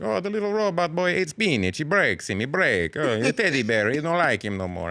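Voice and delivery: sleazy Italian man narrating the film; Italian accent. Retold or imitated. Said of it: "doing it the whole movie".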